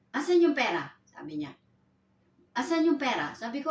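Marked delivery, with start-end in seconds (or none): 0.1-0.9 loud, higher demand for the money; angry demand. Retold or imitated. Employer confronts her at door. 2.6-3.4 raised, insistent demand again for money; angry demand repeated. Retold or imitated.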